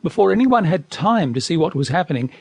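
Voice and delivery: plain reading voice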